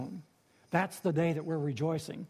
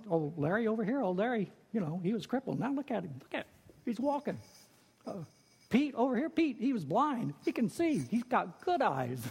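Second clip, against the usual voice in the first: excited storyteller; 5 semitones higher than usual. Acted. Pilgrim pointing out healed friends.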